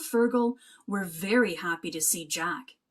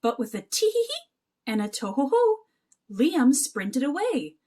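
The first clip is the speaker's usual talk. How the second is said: plain reading voice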